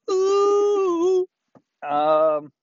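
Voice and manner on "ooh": sung ooh. Acted. Sung falsetto flourish.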